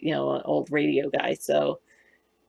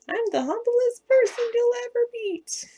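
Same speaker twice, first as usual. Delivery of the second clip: much higher, put-on smug voice; mocking a show-off. Mocking vain people to her chat, in a high smug voice. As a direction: sneer it like someone full of themselves.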